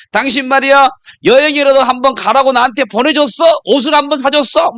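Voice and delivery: high, acted wife's tirade; angry wife. Wife scolding husband over trips and clothes, acted anger. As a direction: pile up accusations like a fed-up wife. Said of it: "막 화가 나가지고".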